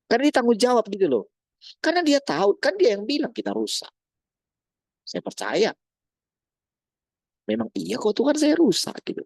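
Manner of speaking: high-pitched, insistent preaching; fervent preaching. Acted. Preacher insisting that God knows we are broken, high and impassioned. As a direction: argue it with rising fervour. Preacher argues with rising fervour.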